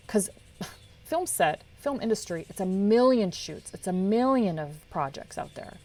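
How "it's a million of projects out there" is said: usual speaking voice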